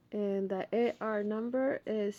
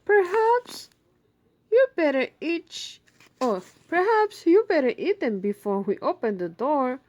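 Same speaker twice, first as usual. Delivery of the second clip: worried Ma; 6 semitones higher, 9 dB louder than usual. Acted. Ma voiced in story.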